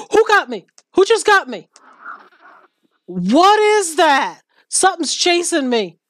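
high, panicked and loud; scared horror game streamer. Real, not acted. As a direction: shriek in panic, being hunted. Chased by a mob in game.

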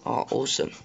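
ordinary talk

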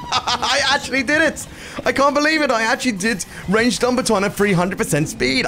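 high, cracking shouts of joy with a laugh; can't believe you pulled it off; ecstatic disbelief. Real, not acted. Streamer finishing a mission at triple speed, high ecstatic shouting.

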